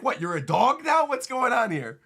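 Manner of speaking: high, loud mock disbelief; hammed-up baffled protest; mock exasperation. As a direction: act out an incredulous outburst. Nami's baffled outburst, acted.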